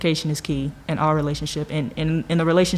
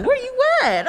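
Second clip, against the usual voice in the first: much higher, whiny clingy voice; needy spam email voice. Retold or imitated. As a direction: voice a clingy email begging for attention.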